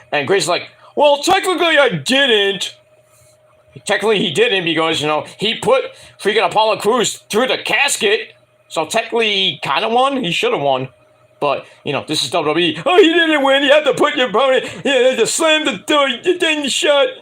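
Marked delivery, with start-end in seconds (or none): 0.9-2.8 high, slow mock whine of protest. Mimics Grayson arguing back. 12.8-17.1 high-pitched mock nitpicking voice; mocking imitation. Mocks company's excuse for match result.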